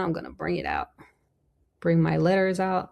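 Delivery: ordinary delivery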